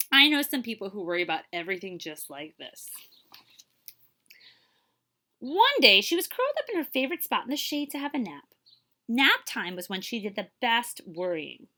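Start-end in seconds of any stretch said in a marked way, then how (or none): none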